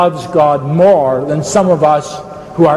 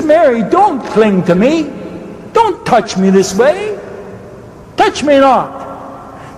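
urgent gentle command; 8 semitones higher, slower than usual. Acted. Voices Jesus speaking to Mary.

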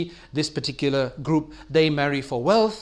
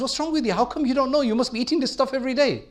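much higher, stunned disbelief, quickening at the end; dumbfounded master; 8 semitones higher, faster than usual. Retold or imitated. Master to his honest gardener, high dumbfounded disbelief. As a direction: ask it as if you can't believe your ears. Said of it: "his master is just completely dumbfounded".

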